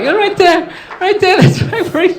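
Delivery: high, loud voice cracking with laughter; laughing mockery. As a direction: point and cackle at the spot. Brothers laughing at dad's footprints.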